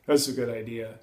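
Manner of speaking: dry, mock agreement; sarcastic. Shown as a demonstration. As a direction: feign approval with a sarcastic edge.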